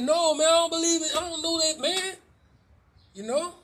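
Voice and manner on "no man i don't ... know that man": Peter's denial, loud and pitched up. Retold or imitated. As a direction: blurt the denial out loud and high. Peter denies knowing Jesus.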